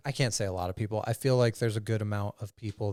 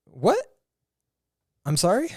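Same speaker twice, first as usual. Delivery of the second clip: mock baffled surprise; 11 semitones higher, 6 dB louder than usual. Shocked reaction in retold scene.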